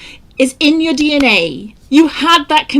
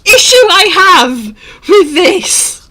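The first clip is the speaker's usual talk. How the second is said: exasperated outburst; 12 semitones higher than usual. Real, not acted. Mounting outburst over a paper.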